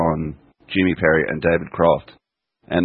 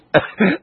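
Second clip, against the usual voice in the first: laugh; 13 semitones higher than usual. Real, not acted. Laughs at own impression.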